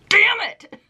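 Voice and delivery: high, mock-annoyed "Damn it"; pretend-angry outburst of love; mock frustration. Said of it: "love something so much you're like".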